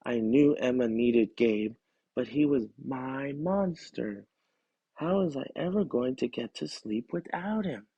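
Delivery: ordinary delivery, nothing marked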